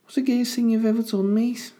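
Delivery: squeaky-high mock Scouse; Liverpool accent. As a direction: put on a very high-pitched Liverpool voice. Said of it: "a Liverpool".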